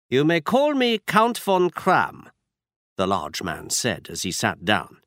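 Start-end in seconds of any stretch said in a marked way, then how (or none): 0.1-2.3 put-on slow, higher German-accented count. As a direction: announce the false name in a German accent. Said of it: "He had a strong German accent".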